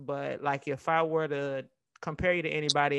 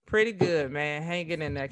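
ordinary talk, nothing marked

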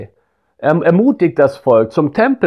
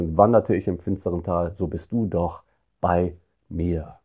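soft, low and slowed psalm words; hushed psalm quote; 7 semitones lower, 7 dB quieter than usual. Acted. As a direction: read it as a quiet prayer. Quoting Psalm 23.

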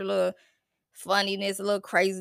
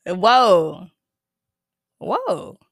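surprised whoa; 2 semitones lower, 8 dB louder than usual. Real, not acted. Surprised by audio.

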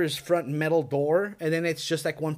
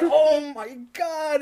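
high, slow, stretched-out retold horror; dread-struck exclamation; 9 semitones higher, 3 dB louder, much slower than usual. Storyteller relives the sleepless fright, long high exclamation. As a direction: draw it out high in disbelief. Acted reaction in scary story.

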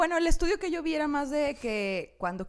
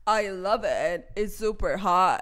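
Paris Hilton impression; 5 semitones lower, much slower than usual. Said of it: "Paris Hilton en su programa ... era de que".

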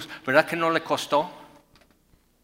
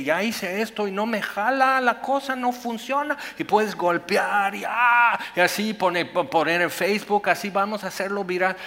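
higher and louder, indignant demands, speeding up at the end; mock complaining client; 7 semitones higher, 6 dB louder than usual. Preacher mimics customers complaining to God, excited and indignant. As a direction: rant like a customer whose product broke.

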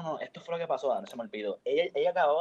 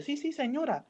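lightly acted, high-pitched polite excuse; friend placating neighbor; 11 semitones higher than usual. Friend excusing the crying to the old neighbor, mock placating. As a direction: imitate a friend smoothing things over.

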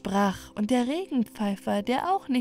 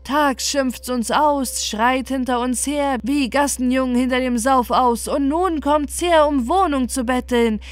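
louder, higher scolding owl voice; 3 semitones higher, 6 dB louder than usual. Acted. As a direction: chase the rascals off the roof. Owls scold the birds outside. (lauter, höherer schimpfender Eulenton)

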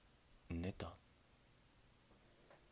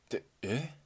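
a sudden high, loud "huh?"; surprised; 6 semitones higher, 6 dB louder than usual. Acted. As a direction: catch yourself, startled to see her. Clerk recognizes the customer. (驚いた声)

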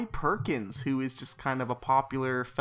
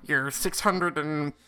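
nerdy know-it-all voice. Retold or imitated. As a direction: play a nerd showing off his math. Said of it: "stands up and is like".

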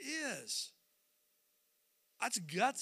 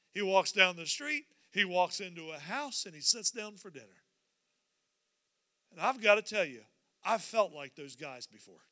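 normal talking voice

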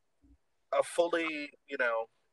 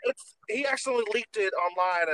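ordinary talk, nothing marked

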